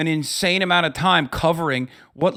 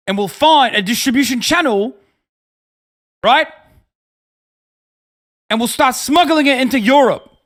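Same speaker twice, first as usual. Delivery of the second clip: high, loud swaggering wannabe-tycoon voice; mock Taliban hustler; 7 semitones higher, 4 dB louder than usual. Streamer voicing a Taliban fighter pitching heroin to Ahmed, loud swagger. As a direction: ham up a cocky get-rich bro pitching a scheme. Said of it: "they're like".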